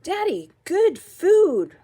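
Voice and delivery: put-on slow, loud, high-pitched kid announcement; big loud little-kid enthusiasm; excited child. A narrator's reading. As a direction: draw out each word, high and gleeful. Child excitedly showing junk food.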